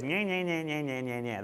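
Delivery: put-on soft, taunting baby-talk babble; mock the preacher like a child would; mocking nya nya. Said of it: "That's how it sounds if you read it in Hebrew".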